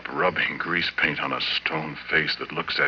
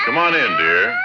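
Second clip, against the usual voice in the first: loud beckoning call with a cry; 5 semitones higher, 9 dB louder than usual. Acted. Husband welcoming his monster wife, loud call. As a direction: call her in, louder.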